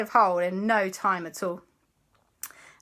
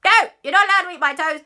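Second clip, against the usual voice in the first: fast, high, loud refusal; protesting child; 10 semitones higher, 9 dB louder, faster than usual. Acted. Christopher refuses the pirate ghost, shrieky fast protest.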